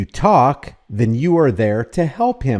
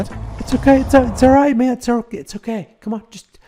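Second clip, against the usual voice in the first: Frank Sloop cajoling; 10 semitones higher, faster than usual. Retold or imitated. Commentator mimics officer soothing a nervous driver, mock-reassuring. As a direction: imitate an officer coaxing, high and cooing.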